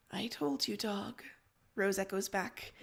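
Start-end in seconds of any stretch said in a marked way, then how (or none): 0.1-1.2 quiet, small frightened voice; a touch of fear, barely audible; slightly terrified. Acted. Rose echoing back the warning, slightly terrified. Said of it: "in a slightly terrified voice".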